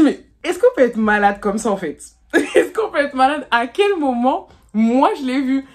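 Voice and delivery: a mock-outraged, laughing protest; incredulous outburst. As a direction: act out being stunned he's accusing you. Quoting her own disbelief.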